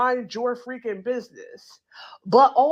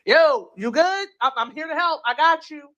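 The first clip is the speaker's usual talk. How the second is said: bullhorn call; 4 semitones higher than usual. Retold or imitated. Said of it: "Did they have a bullhorn?".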